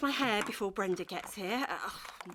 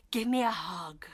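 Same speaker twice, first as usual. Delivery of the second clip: tender; 6 semitones lower than usual. Acted. Tender reunion.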